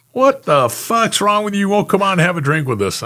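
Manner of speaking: high, mock drunk whine; hammed-up drunk grumble; drunk complaint. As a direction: needle him for not coming out.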